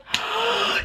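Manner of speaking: sharp high gasp of recognition; excited gasp. Retold or imitated. Waiter realizing who she is, a high excited gasp. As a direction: gasp like a starstruck fan.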